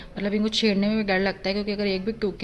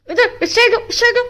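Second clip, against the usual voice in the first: calling the dog; 8 dB louder than usual. Real, not acted. Telling the dog to turn.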